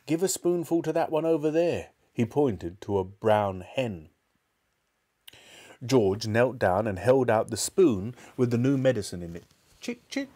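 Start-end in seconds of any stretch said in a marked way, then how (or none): 0.1-1.9 higher, mildly excited order; eager cry. A narrator's reading. Mister Cranky directing George to the hen, eager higher cry. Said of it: "cried Mister Cranky". 9.8-10.3 very high, light little call; coaxing call. A narrator's reading. George offering the hen a spoonful, high coaxing call.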